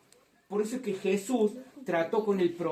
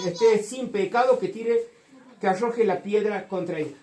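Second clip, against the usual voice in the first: fervent pulpit delivery, much louder; full-force preaching; 7 dB louder than usual. Acted. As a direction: proclaim it to the whole church. Preaching the adulteress passage loudly.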